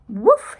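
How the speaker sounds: loud, rising woof bark; loud yappy woof; dog's woof. Acted. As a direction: bark it out like a small dog. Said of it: "he said".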